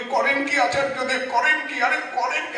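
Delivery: high, flustered protest; startled protest. Retold or imitated. Haridas protesting to the teacher, high and flustered. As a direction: protest humbly at being seated so high. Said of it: "বলে".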